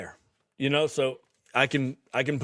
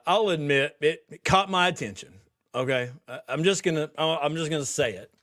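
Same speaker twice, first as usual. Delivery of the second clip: normal talking voice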